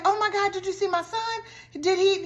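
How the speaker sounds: high, breathless panicked asking; frantic mom. Retold or imitated. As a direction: beg strangers for help finding your son. Mom searching for lost son.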